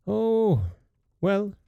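high, reluctant dwarf voice; grudging dwarf. A narrator's reading. Dwarf pretending reluctance, high grudging voice. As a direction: act as if doing a favour.

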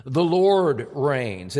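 The Lord reigns said very slowly, Lord pushed out first; slow and heavy, landing on Lord; emphatic, slow stress on the Lord. Shown as a demonstration. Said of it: "is placed emphatically at the very start".

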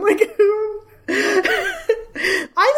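high, laughing through the words; can barely talk for laughing. Real, not acted. Laughing at the writing.

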